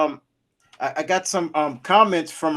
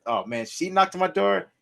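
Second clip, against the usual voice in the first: ordinary talk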